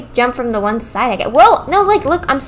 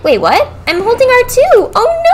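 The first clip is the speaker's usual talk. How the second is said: being chased; 7 semitones higher, 3 dB louder than usual. Real, not acted. Fleeing a monster in game.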